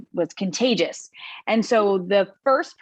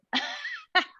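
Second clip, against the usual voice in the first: high little embarrassed giggle; shy giggle; 6 dB quieter than usual. Real, not acted. Speaker laughing off praise from the group, shy giggle. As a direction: laugh it off after being praised. Put on the spot, then praised.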